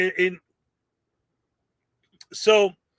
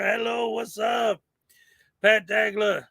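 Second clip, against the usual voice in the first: normal talking voice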